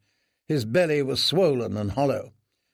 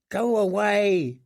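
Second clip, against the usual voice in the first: high, slow pleading go away; terrified prayer; 7 semitones higher, slower than usual. Acted. Prisoner praying the jailer passes by, high fearful plea. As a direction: beg the footsteps to pass by. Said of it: "he prayed".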